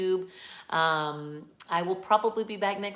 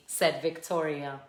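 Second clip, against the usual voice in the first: ordinary delivery, nothing marked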